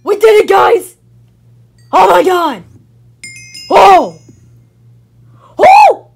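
high, loud whoops of victory; triumphant shouting. Real, not acted. Gamer telling viewers they did it, triumphant shouting. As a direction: explode into celebration, yelling. Celebrates winning the game.

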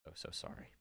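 whispered apology. Real, not acted.

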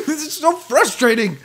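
high-pitched exasperated whine; real, half-amused frustration; frustrated. Real, not acted. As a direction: let the frustration push your pitch up. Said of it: "I'm getting frustrated".

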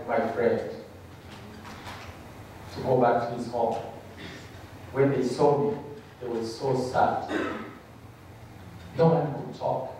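ordinary talk

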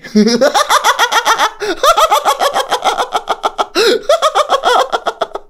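long, high wheezing laugh; laughing fit. Real, not acted. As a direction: burst out laughing and keep going. Cracks up at own deep voice.

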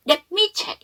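"Let me check" with a stop after "Let"; deliberate break. Shown as a demonstration. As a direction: hold the T of "Let" unreleased, then "me check". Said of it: "잠동화 못 일어나게".

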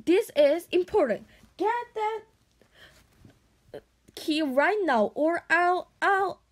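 high, insistent order; urgent insistence. A narrator's reading. Caged Hiccup orders Toothless to get the key, urgent. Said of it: "whispered Hiccup as loud as".

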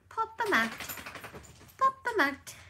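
bright coaxing to the dog; excited pet cheer. Real, not acted. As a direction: cheer her on up high.

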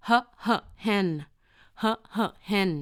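"hen" built up from two short "huh" sounds; slow, separated "huh, huh, hen"; phonics sounding-out. Shown as a demonstration. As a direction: puff the h twice, then say "hen". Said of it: "แต่ออกเสียงว่า".

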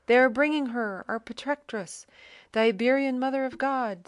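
a soldier crying out as the icon arrives; cried out. A narrator's reading. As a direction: call out in awe across the crowd. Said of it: "someone cried".

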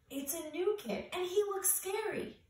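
high, rising scared voice; frightened cry. A narrator's reading. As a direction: panic as the shadow nears. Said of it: "cried Joey Mackerel".

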